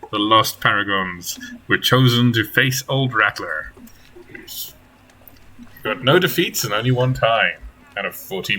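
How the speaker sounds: slow storytelling recap; story narration. Acted. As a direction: recap the last session like a storyteller. Game master recap narration.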